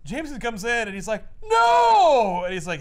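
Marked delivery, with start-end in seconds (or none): 1.4-2.4 very high, loud anguished no; mock horrified shout. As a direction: yell it like your worst nightmare walked in.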